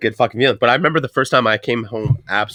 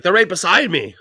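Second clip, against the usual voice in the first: high mock insisting; a hint of an exasperated dad; 7 semitones higher than usual. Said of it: "He's like".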